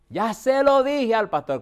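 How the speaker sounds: put-on loud, slow, high cry of an old woman; retold shout. Old mother shouting the news to the doctor, loud and slow. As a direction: belt it out like she's exposing her daughter. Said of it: "esa mujer gritó y dijo eso".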